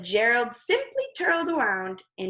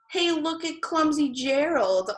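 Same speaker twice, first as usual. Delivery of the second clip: mocking jeer from a crowd; sneering; 6 semitones higher than usual. A narrator's reading. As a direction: taunt him with a sneer. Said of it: "all the animals sneered".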